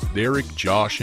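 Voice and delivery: ordinary delivery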